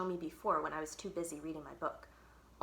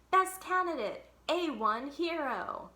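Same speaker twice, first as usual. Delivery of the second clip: slogan announcement; 8 semitones higher, 4 dB louder, slower than usual. Acted. Poster slogan boomed.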